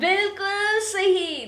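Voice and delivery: high, slow, drawn-out praise; big slow cheer of approval; excited praise. Real, not acted. Praising child's answer.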